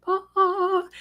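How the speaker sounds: high, drawn-out ghostly "ooh"; mock spooky wail. Teller teasing the Amityville visit, high mock-spooky wail.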